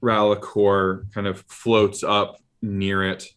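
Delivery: ordinary talk, nothing marked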